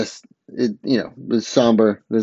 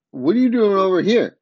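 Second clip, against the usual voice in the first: higher-pitched challenge, acted out; mock confrontation; 8 semitones higher, 4 dB louder than usual. Supergirl confronts Weather Witch, mock confrontation. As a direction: lightly act out a surprised challenge.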